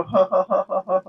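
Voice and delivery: high, rapid little laugh; short string of ha-ha-ha; light laugh. Real, not acted. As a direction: laugh lightly in a quick ripple. Laughs during relaxation countdown.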